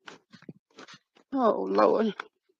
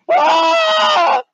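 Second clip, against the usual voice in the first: scream; 4 dB louder than usual. Acted. Screaming in fright in the woods.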